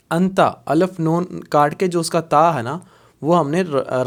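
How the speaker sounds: normal talking voice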